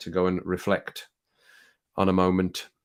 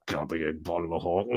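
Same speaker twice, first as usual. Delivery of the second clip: mock growl; 5 semitones lower, 8 dB quieter than usual. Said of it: "the fist and the growl".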